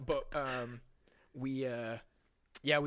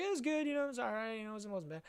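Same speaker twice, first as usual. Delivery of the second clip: much higher, placating tone; high-pitched reassurance; 9 semitones higher than usual. Retold or imitated. Telling girlfriend the movie was okay, high placating voice. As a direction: soften the verdict in a raised pitch.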